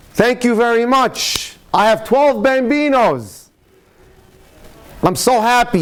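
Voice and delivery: a hint of a beaming Italian dad; grateful Italian prisoner. Italian prisoner thanks the warden.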